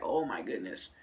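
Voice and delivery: low, soft thought voice; quiet retold "in my head" dread; inner dismay. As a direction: murmur it to yourself, pitch dropped. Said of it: "what's going on in my head".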